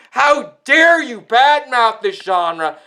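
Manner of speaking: ordinary delivery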